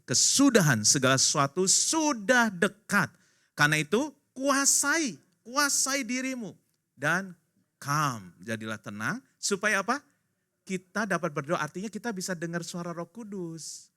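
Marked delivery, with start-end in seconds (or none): 0.1-9.2 slow, high, loud solemn reading; scripture reading. Acted. Preacher reads First Peter to the congregation, slow and proclaiming. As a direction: proclaim the verse slowly and with weight. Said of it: "satu Petrus empat ayat tujuh bilang begini".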